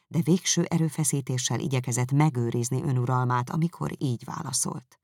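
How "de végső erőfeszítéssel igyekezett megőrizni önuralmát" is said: ordinary delivery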